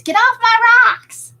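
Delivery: put-on high, loud grumpy old neighbour yell; hammed-up shout to get off the rocks; a cranky old lady hollering at kids; mock yell at kids. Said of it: "yelling at the kids".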